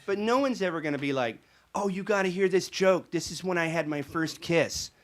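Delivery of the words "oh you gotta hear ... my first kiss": high, louder gushing request; hammed-up sentimental enthusiasm; mock eager fan. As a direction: imitate a fan begging to share a favorite. Imagined fan requesting a joke.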